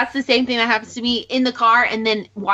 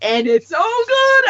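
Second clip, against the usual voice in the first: enthusiastic; 11 semitones higher, 3 dB louder, slower than usual. Real, not acted. Recommending a podcast.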